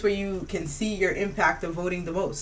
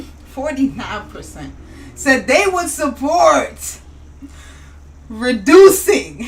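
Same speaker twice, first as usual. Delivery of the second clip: slow dramatic read; 4 semitones higher, 6 dB louder, slower than usual. Shown as a demonstration. Dramatic slow poll reveal.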